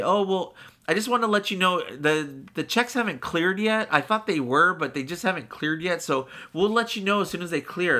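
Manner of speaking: high, sweet customer-service voice; bright teller voice. Retold or imitated. Teller breaking news politely, high sugary tone. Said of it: "they come out and they say".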